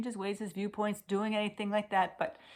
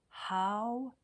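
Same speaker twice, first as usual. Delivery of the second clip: ordinary delivery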